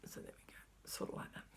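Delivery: hushed, under the breath; soft whisper to herself; whispered aside. Real, not acted. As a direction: murmur it to yourself. Whispering aside while folding fabric.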